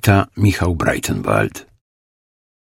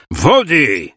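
higher, clipped command; curt order; 6 semitones higher than usual. A narrator's reading. The leader orders water thrown on the woman, curt. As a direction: snap the order at his men. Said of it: "rozkazał najważniejszy".

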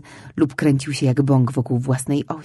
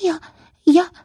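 stammering from nerves; nervous stammer; 14 semitones higher, 5 dB louder than usual. A narrator's reading. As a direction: stumble over it, nervous. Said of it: "jąkając się ze zdenerwowania". (jąkając się z nerwów)

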